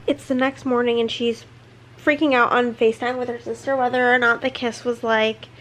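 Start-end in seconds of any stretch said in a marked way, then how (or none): none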